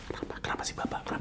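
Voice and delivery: hushed puzzled whisper; whispered wondering. Retold or imitated. Congregation murmuring about the pastor, whispered. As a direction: whisper it like confused churchgoers.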